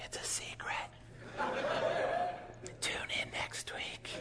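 hushed, secretive whisper; a TV host whispering a cliffhanger to his viewers. Acted. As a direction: whisper it like a teaser for next week's show. Teasing secret told to listeners.

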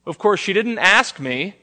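ordinary talk, nothing marked

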